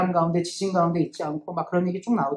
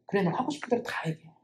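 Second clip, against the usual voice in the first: much softer, calm and kind; gentle invitation; 8 dB quieter than usual. Acted. God gently inviting Elijah to speak: soft, tender. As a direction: invite him to pour it all out.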